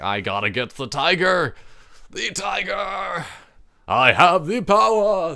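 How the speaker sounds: building from eager to a slow, high, shouted battle cry; hammed-up gamer frenzy. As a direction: ham it up like you've seized ultimate power. Excited gamer chasing the tiger.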